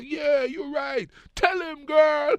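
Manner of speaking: loud, high, drawn-out cheer; egging-on parent. Retold or imitated. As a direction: holler like a parent cheering from the bleachers. Voicing parents cheering kids on.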